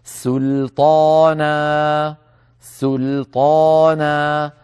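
"سلطانا" slowly, thin seen and thin lam, then the heavy taa; correct light seen. Shown as a demonstration. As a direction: keep the seen of "سلطانا" light, never like saad. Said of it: "هكذا". ("سلطانا" بسين مرققة ولام مرققة ثم طاء مفخمة ببطء)

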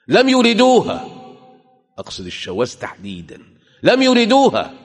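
shouted, high and loud; loud, high pulpit outcry; fiery cry. Real, not acted. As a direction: cry it out in condemnation. Preaching against the deviants.

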